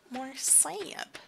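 wistful whisper. Real, not acted. Wishful aside to herself.

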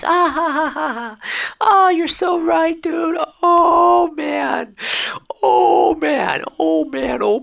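very high, loud wailing falsetto; mock hysterics. Reacting to a commenter's joke, hammed-up falsetto hysterics. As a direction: ham up squealing, overcome agreement.

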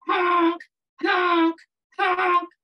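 loud honks like a truck horn; honking shout. Acted. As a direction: blast out each honk.

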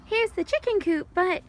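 ordinary talk, nothing marked

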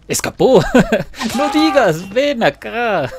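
high, loud exclamation through laughter; laughing outcry. Real, not acted. Hunter calling after an escaped victim, laughing outcry. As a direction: laugh while calling the runaway back.

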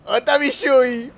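high, loud, drawn-out call; playful calling out. Acted. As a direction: sing out for your missing buddy, teasing. Repeats it, calling out to rival.

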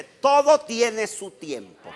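high, loud, slow proclamation; proclaimed scripture. Real, not acted. Pastor quoting the Bible to the congregation, loud proclamation. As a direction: ring it out as a Bible verse.

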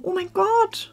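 suddenly amazed by the gift; surprised gasp. Real, not acted. Opening a fan's surprise gift, high amazed gasp.

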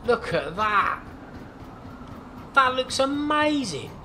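high, slow awe at the view; awestruck. Real, not acted. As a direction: let the wonder stretch out high and slow.

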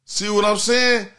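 high sing-song over music; high preachy rant. Acted. Calling out people who say fuck the police, high sing-song.

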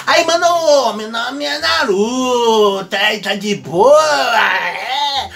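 rude slang intro. Retold or imitated. Teacher translating Naruto into a loud, cocky slang voice. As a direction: swagger like a street kid. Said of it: "como se chegasse e falava".

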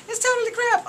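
high, loud, scornful; high-pitched scornful outburst; indignant. Real, not acted. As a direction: spit it out in outrage. Ranting about VIP.

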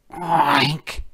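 pig oink. A narrator's reading. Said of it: "said the pig".

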